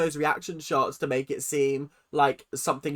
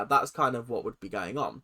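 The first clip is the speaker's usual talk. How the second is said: usual speaking voice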